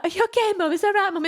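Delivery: put-on high, gentle child voice, reassuring; a small girl soothing her mum; worried little girl. Daughter comforting mum, quoted.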